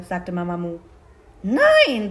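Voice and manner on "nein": high, loud crow no; energetic, drawn-out no; emphatic refusal. Acted. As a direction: refuse point-blank as the crow. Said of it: "sagte die Krähe und schüttelte energisch den Kopf".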